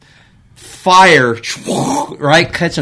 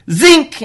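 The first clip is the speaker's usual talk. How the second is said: hose snap sound; 13 semitones higher than usual. Retold or imitated. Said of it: "hose snapping sound".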